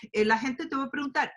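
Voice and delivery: ordinary talk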